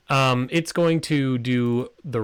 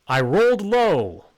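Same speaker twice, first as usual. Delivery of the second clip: dismayed; 10 semitones higher, 7 dB louder than usual. Real, not acted. GM groans at low roll.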